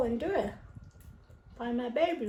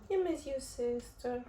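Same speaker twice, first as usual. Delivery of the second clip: ordinary talk, nothing marked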